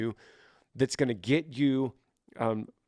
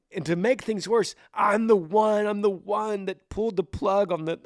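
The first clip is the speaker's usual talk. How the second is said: high, pleading outburst of grief; anguished self-blame; 7 semitones higher than usual. Acted. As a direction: protest in acted despair, pitch climbing. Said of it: "two different personalities fighting each other".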